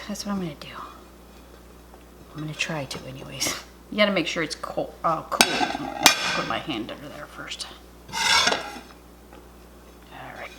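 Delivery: hushed focused whisper. Real, not acted. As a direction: whisper while concentrating. Carefully inverting the hot dish.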